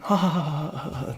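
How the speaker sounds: mock laugh, fading out; imitated uneasy laugh. Bullies backing off from the kid, mock uneasy laughter. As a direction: imitate laughter that trails away softly.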